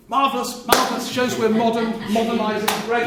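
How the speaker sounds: high, loud, gushing delight; overjoyed politician, pleased with himself; mock delight. Cameron hearing his candidate is a woman, gushing high and loud.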